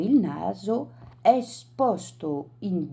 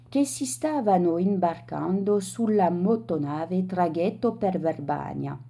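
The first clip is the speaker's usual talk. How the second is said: ordinary delivery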